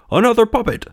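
high-pitched delighted exclamation; squealing stage-puppet voice; excited cry. A narrator's reading. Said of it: "they cried".

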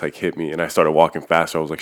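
normal talking voice

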